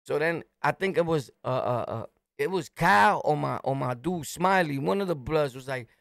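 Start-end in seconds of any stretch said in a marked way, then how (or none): none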